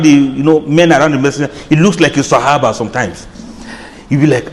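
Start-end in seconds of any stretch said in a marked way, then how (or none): none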